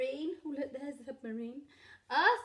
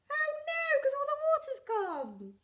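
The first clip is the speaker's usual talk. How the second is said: high character voice; 5 dB louder than usual. Acted. Character dismay.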